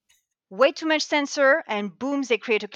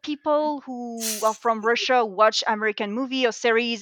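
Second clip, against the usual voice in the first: usual speaking voice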